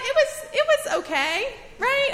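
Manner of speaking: high, wry "it was okay, right?"; wry understatement. Real, not acted. Mother telling the congregation, wryly high and ironic. Wry aside about church struggles.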